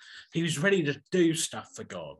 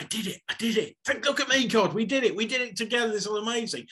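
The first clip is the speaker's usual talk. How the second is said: high, loud jubilant cry of triumph; excited cheer; 8 semitones higher, 3 dB louder, faster than usual. Retold or imitated. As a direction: crow it out like a man who just won. Elijah celebrating after the miracle.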